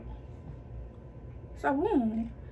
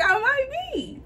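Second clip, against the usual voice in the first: much higher "I might be", giggling nervously; nervous laughing repeat; 12 semitones higher, slower than usual. Retold or imitated. To her mother in the car, nervous giggling repeat.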